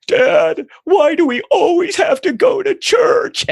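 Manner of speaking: put-on very high, loud, drawn-out kid whine; hammed-up high-pitched child protest; whining four-year-old. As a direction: imitate a small child wailing a complaint to dad. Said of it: "my four-year-old".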